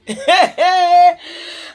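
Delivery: a burst of real laughter; hearty cackling laugh; loud laugh. Real, not acted. Laughing at the mockery.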